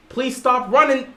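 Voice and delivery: usual speaking voice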